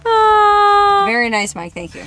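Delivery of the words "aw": high, loud, drawn-out aww; long cooing aww, pitched up; touched. Real, not acted. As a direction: melt at a sweet compliment. Touched by his sweet remark.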